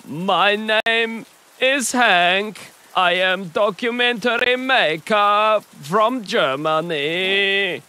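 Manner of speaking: hammed-up German filmmaker; German accent. German cameraman scolding the noisy pair, in a thick hammed-up German accent. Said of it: "this very accurate accent".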